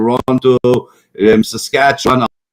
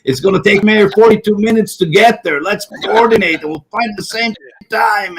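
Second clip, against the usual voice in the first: mocking the plotters; 8 semitones higher than usual.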